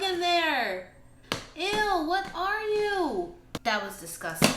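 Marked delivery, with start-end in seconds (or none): 1.6-3.3 high, slow grossed-out squeal; disgusted. Real, not acted. Plant vlogger finds a bug, high disgusted squeal. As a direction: recoil at the bug in the pot.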